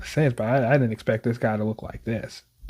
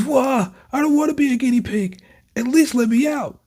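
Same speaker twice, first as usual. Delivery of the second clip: whining; 12 semitones higher than usual. Acted. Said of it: "Quit whining".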